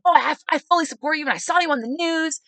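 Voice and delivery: enthusiastic supporter. Retold or imitated. Supporter recognising the candidate from TV, enthusiastic. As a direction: pitch it up and gush.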